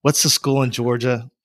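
usual speaking voice

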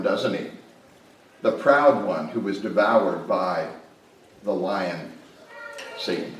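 ordinary talk, nothing marked

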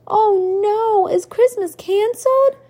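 high, loud worried cry; a boy fearing Christmas is off; alarmed child. A narrator's reading. Child alarmed by blank screen.

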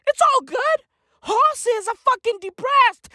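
the catchphrase in a high, squeaky voice; high mock chirp. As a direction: chirp "It's all good" up high, "all" stretched. Rant opens with the catchphrase.

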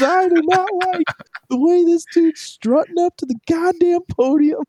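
high, strained ranting father; angry Jets dad. Retold or imitated. Jets fan dad warning his son about Brady, high strained rant. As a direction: crank the pitch up like a fed-up dad lecturing. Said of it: "He goes".

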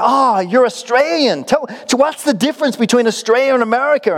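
put-on high-pitched, curious American tourist accent; hammed-up American accent, asking eagerly; bad American accent. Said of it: "This is a terrible accent".

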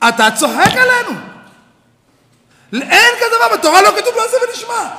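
high, loud mock protest; imitating indignant student. Rabbi voicing a student protesting loudly, mock indignant. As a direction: play the student calling him out.

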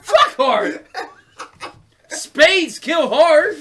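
loud high laughing scoffs; ranting through laughter, slowing at the end; laughing mock rant. Real, not acted. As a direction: rant at it, cracking up.

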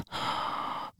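a sharp unvoiced intake of breath; excited gasp. Release signed, heading back to the Marines, excited gasp. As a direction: gasp with joy at being freed.

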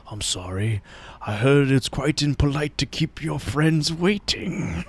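calm wise voice. Retold or imitated. Old friend teasing the Sandman for being late, slow and gentle. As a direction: deliver it gently and slow, like a sage. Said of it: "He's like".

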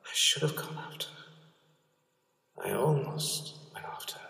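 slow, breathless regretful whisper. Acted.

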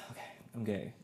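soft, sighing it out to yourself; quiet sighed admission. Retold or imitated. Lying in bed, admitting it to himself, softly sighed. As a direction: breathe the words out alone in bed.

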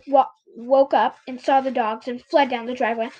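ordinary delivery

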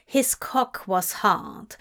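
plain reading voice

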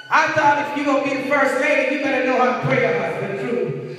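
loud, high, emphatic sermon delivery; forceful preaching. Real, not acted. Preacher warning a church of deception, forceful preaching. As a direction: preach it out, leaning hard on key words.

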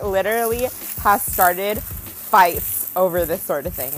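ordinary talk, nothing marked

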